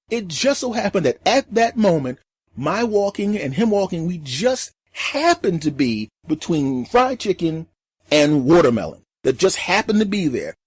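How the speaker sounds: ordinary talk, nothing marked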